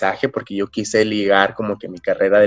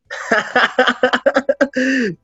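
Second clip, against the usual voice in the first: bright high laugh; high burst of laughter. Real, not acted. Laughs after joking about money.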